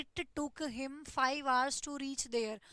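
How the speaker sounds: very slow, word by word; slow model sentence; slow dictation. Shown as a demonstration. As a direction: dictate the simple sentence slowly. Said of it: "sentence simple बना दिया कि".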